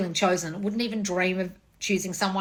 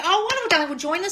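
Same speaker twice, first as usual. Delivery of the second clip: much higher and gushing; mock sweet high voice; 10 semitones higher, 4 dB louder, faster than usual. To a fan saving up, a high cooing voice. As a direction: coo it up high like a pleased diva.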